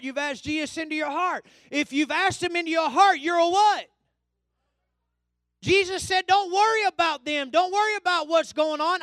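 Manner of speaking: high, loud pulpit exhortation; full-force preaching. Real, not acted. Preacher telling believers to claim victory at the mirror, full-force exhortation. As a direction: preach hard, driving each phrase home.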